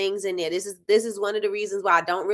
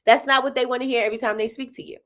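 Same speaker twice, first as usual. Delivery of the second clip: ordinary talk, nothing marked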